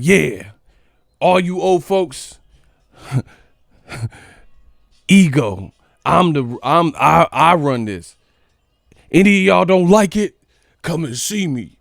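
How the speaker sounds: playful bully bluster; mock tough-guy challenge. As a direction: call them all out, half-joking. Acting tough at senior home.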